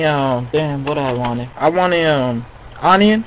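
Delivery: ordinary talk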